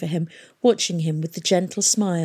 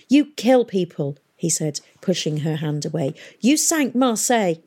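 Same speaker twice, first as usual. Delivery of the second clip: angry accusation. Acted. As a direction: push the blame at her. Tom accuses Miss Fang.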